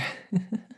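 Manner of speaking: breathy unvoiced chuckle; a light amused breath of a laugh. Doctor laughs at the transformed patient.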